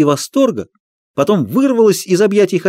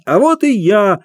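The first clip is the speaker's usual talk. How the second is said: high shout, clown voice; loud shout; 7 semitones higher, 3 dB louder than usual. A narrator's reading. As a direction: call out to the crowd. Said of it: "громко крикнул хозяин". (громкий клоунский крик)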